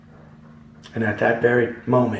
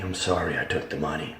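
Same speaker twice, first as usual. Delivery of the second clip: quiet hurried apology; 5 semitones lower, 8 dB quieter, faster than usual. Retold or imitated. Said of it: "many of them screaming".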